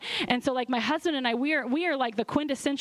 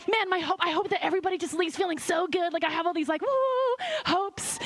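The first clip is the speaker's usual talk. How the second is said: higher, bubbly excitement with a whoop on woo; gushing hopes; 7 semitones higher than usual. Retold or imitated. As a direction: gush to your husband about big hopes. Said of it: "I'm like".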